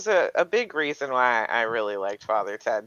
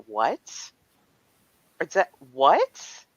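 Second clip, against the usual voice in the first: mock bewilderment, pitch jumping up on the questions; baffled retelling; 6 semitones higher than usual. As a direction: act out baffled, incredulous questions. Retelling her baffled messages about accent.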